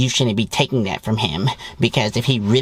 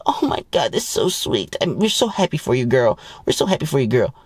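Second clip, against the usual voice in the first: fake happy friend; 3 semitones higher than usual. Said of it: "And the girl's like".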